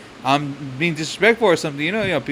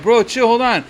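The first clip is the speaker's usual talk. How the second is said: telling him to chill; 7 semitones higher, 4 dB louder than usual. Retold or imitated. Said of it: "and I'm like".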